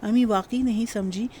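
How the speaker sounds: plain reading voice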